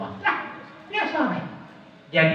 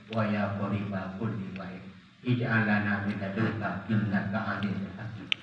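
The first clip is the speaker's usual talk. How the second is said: low, soft Arabic prayer, growing ever quieter; prayer recitation; 4 semitones lower, 5 dB quieter than usual. Acted. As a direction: recite the prayer softly to God. (doa dibaca lirih dan khusyuk)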